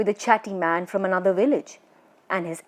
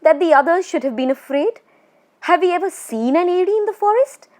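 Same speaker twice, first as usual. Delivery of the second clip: louder and higher, mocking the others' fear; scoffing aloud; 9 semitones higher, 5 dB louder, faster than usual. A narrator's reading. Lone walker scoffing aloud on the dark road, louder, high. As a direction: laugh off the ghost story out loud. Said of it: "he said aloud".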